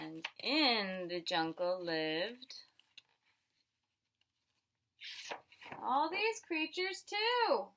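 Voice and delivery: put-on slow, wondering read to a child; storybook wonder. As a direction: draw the child into the jungle.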